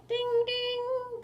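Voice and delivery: high sing-song bell chime; high chiming ding ding. Acted. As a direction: ring it out like a bell. Bell rings at sunrise.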